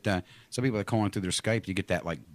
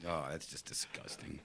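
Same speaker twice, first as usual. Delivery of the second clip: disgusted; 7 semitones lower, 16 dB quieter, slower than usual. Real, not acted. Disgusted after the gross story.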